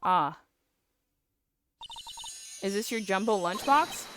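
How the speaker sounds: ordinary talk, nothing marked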